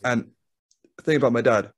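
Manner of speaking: ordinary talk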